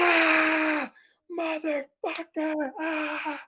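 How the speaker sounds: high, loud cry of pain from a dog bite; pained yell. Retold or imitated. Retelling the dog bite to his friend, a high pained yell. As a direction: yelp it out like teeth in your behind.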